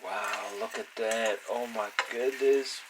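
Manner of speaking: ordinary delivery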